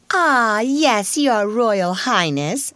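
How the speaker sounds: high, drawn-out mock deference; sarcastic, exaggerated homage. A narrator's reading. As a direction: sneer at a rival's royal airs. Lavinia mocks Sarah as royalty.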